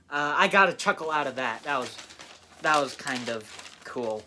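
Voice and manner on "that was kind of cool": normal talking voice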